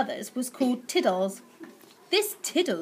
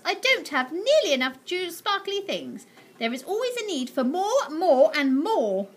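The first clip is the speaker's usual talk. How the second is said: high, slowing greedy voice; greedy aunt; 6 semitones higher than usual. Acted. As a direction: savour every more.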